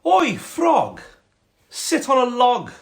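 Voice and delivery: high, loud snobby cat voice. Acted. Cat bossing a frog onto a log, snobby and high. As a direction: order the frog about as a snooty cat. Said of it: "quite a snobby cat".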